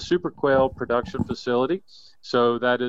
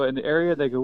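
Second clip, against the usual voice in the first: ordinary delivery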